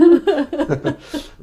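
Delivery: high-pitched laugh burst; high giggle. Real, not acted. Laughing at own pronunciation.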